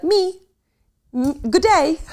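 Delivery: high, hammed-up fake Australian accent; mock Australian. Mocking the liar's fake Australian, hammed-up Australian accent. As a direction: put on a cartoon Australian accent. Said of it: "and then be like".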